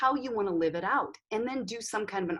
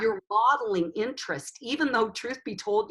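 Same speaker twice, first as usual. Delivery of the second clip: usual speaking voice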